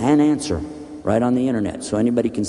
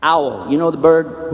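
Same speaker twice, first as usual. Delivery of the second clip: ordinary talk, nothing marked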